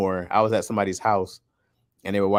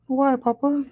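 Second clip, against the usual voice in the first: put-on very high little-kid voice; Izzy's voice; slower than usual. Dad imitating his kid questioning Papa, squeaky-high child voice. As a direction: mimic a child asking, pitched way up. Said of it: "I thought you were doing Izzy's voice".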